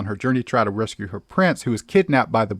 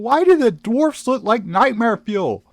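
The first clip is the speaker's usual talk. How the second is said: appalled; 13 semitones higher than usual. Real, not acted. Appalled by the remake.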